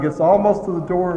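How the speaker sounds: ordinary talk, nothing marked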